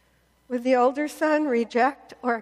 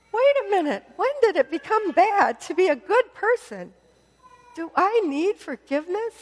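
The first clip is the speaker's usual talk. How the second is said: affronted disbelief; 7 semitones higher than usual. Acted. Older brother reacting to being forgiven, affronted. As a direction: recoil in disbelief.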